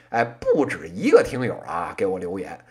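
ordinary talk, nothing marked

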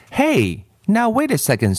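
mildly acted indignation; protesting. A narrator's reading. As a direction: cut in to object, pitch jumping up. Ron objects to being blamed.

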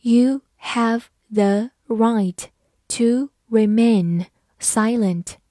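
slow, even reading pace; careful slow model reading. Shown as a demonstration. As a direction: read it slowly word by word. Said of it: "好，慢慢来读。".

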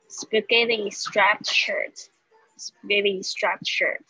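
"spaghetti striped shirt" said slowly with stress on "spaghetti"; careful drill pace; slow model reading. Shown as a demonstration. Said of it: "我就是先念这些单词".

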